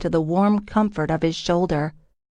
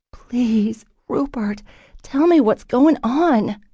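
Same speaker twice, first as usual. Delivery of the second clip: desperate plea; 6 semitones higher, slower than usual. A narrator's reading. Heroine desperately pleads.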